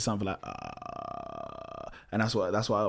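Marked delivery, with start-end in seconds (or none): 0.4-1.9 very low creaky vocal fry demo. Shown as a demonstration. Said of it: "what a vocal fry is".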